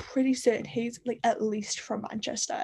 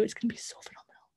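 excited whisper; 8 dB quieter, faster than usual. Real, not acted. Gushing about an upcoming book.

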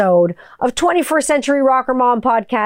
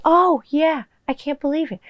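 Jennifer Aniston; 5 semitones higher than usual. Shown as a demonstration. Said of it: "Jennifer Aniston. I can do vocal matching".

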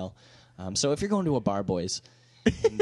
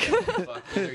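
laughing; 3 dB louder, slower than usual. Real, not acted.

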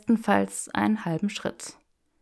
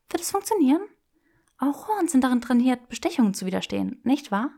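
put-on higher, playful young woman; teasing Hermione voice; 6 semitones higher, faster than usual. Granger teasing Draco in the bookshop, playful and higher. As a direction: tease him with mock refusal.

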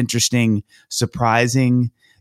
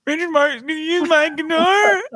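starstruck squeal. Retold or imitated. Young intern retelling his answer about lending a guitar, starstruck squeal. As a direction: squeal it like an overexcited fan, mocking yourself.

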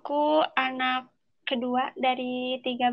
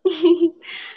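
short awkward giggle; a flustered little laugh; embarrassed laugh; 4 semitones higher, 9 dB louder than usual. Real, not acted. Laughs at motto question.

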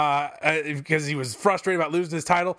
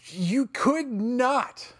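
slow, stretched "could not"; drawn-out scorn; 4 semitones higher, much slower than usual. Real, not acted. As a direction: drag each word out in contempt. Ranting at WWE.

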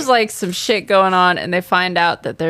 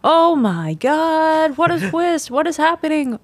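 mock amazement; 10 semitones higher than usual. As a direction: gasp at the twist with hammed-up astonishment. Mock shock at plot twist.

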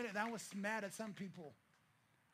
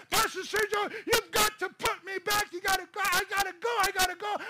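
much louder and higher, begging over and over; passionate pleading shout; 10 semitones higher, 12 dB louder than usual. Retold or imitated. Begging his pastor to let him go back, crying shout. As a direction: plead at the top of your voice. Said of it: "I'm passionately pleading".

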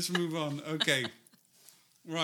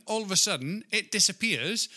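ordinary delivery

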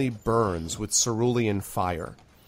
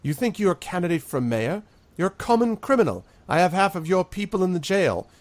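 scornful, higher laughing dismissal; the mayor sneering at Sharky; mocking laugh; 7 semitones higher, 3 dB louder than usual. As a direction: laugh off a criminal's bid. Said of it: "the mayor sort of laughs and says".

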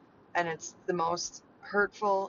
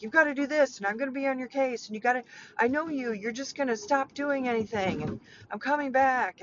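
higher-pitched, quick mock nagging; Michael riling her up; 5 semitones higher than usual. Stepdad pressing her to do more, imitated nagging. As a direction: rattle off demands, imitating someone on your case. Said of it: "after kinda riling me up".

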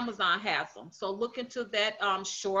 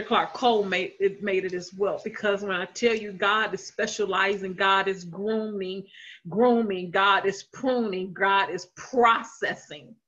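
preaching; 5 dB louder than usual. Acted. Building sermon list.

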